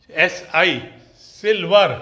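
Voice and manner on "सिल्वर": सिल्वर loud, in two parts, 'sil-var'; 'sil-var', loud and syllable by syllable in सिल्वर; clear s sound. Shown as a demonstration. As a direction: punch out सिल्वर starting straight on the s. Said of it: "सा की sound आ रही है".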